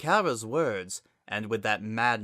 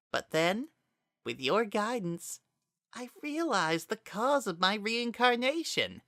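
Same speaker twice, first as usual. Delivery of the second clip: higher, eerie possessed voice speaking through Azriel; a dark spirit wearing a friend's voice, higher; possessed Kara voice; 13 semitones higher than usual. A narrator's reading. Kara confronts Frisk, speaking as eerie higher voice.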